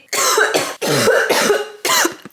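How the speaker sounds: real cough from a cold, rising and strained; coughing fit. Real, not acted. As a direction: cough hard mid-sentence. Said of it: "je tousse encore un petit peu".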